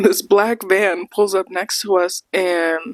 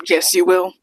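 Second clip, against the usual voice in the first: laughing. Retold or imitated. Laughing reply to Hayden.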